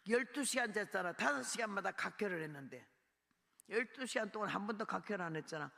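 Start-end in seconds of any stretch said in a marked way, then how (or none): none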